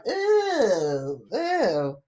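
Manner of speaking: drawn-out disgusted ew; reacting to a pat-down near her diaper, disgusted. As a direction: recoil at the thought. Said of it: "And I'm like".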